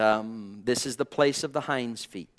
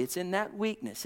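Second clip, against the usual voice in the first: ordinary talk, nothing marked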